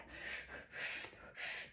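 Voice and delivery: a long, soft, breathy exhale; long sigh. Retold or imitated. Mom on gas and air during labour, long weary sigh. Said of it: "but I was just like".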